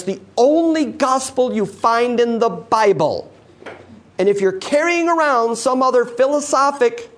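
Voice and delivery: fervent preaching. Acted. Preacher warning the church against works, fervent. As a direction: press the congregation to rethink their gospel.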